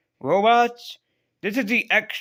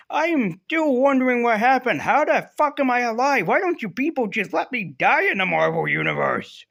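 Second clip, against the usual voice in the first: exasperated; 6 semitones higher than usual. Retold or imitated. Retold Cyclops bewildered at being revived.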